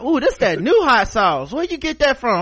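high, curious cashier; pry like a nosy cashier. Retold or imitated.

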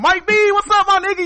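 very high, loud friendly greeting; excited greeting. Retold or imitated. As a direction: greet a buddy with big joy. Friends greet him warmly.

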